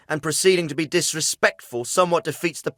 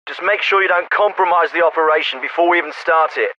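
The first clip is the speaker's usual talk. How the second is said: military officer over a field radio; crisp orders through a narrow radio channel; radio transmission. Acted.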